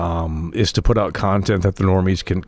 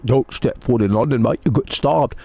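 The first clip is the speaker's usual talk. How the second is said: mock British accent; 3 semitones higher than usual. Streamer quoting British viewers about London, in a mock British accent. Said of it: "they said".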